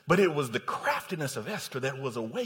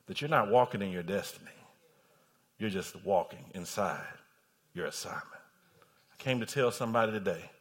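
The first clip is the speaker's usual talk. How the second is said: low, hushed pulpit charge with long pauses; grave, measured word to the congregation; solemn preaching; 8 semitones lower than usual. Real, not acted. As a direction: bring it right down and let each phrase land.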